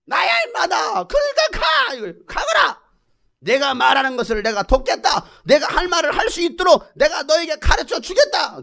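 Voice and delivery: high, forceful commanding voice; exasperated God. Acted. God sends Moses off with a promise, exasperated. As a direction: order him off, out of patience.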